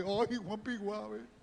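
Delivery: slow, drawn-out mocking "Ay"; mock fawning. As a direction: tease the naive exorcist. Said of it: "conversar con los demonios". (tono burlón y lento)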